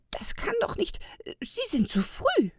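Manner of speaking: high, startled blurt; blurted in alarm. A narrator's reading. Old elf to himself as the train comes early, alarmed blurt. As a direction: let it slip out in alarm, high-pitched. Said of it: "entfuhr es ihm".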